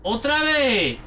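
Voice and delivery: normal talking voice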